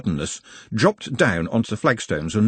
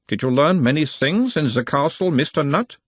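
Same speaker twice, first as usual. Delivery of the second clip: put-on a mild Uberwaldian accent, the higher voice of a gentle examiner; 6 semitones higher than usual. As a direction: question him in a light Uberwald accent, calming the patient. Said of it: "in a mild Uberwaldian accent".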